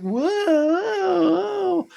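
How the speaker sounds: very high, loud, teetering whoa; hammed-up panicked whoa while wobbling; mock wobbling whoa. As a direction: yelp like you're losing your balance. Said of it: "he's like".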